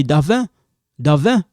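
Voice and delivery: "Davin" said davɛ, the final d dropped; clipped Davin. Shown as a demonstration. Teacher showing how people clip the name. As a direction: cut "Davin" short to a nasal ending. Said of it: "You're gonna hear people say".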